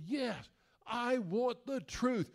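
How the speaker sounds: hammed-up courtroom demand, slow; Tom Cruise impression. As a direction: drag it out, the young lawyer demanding. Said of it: "And Tom Cruise goes".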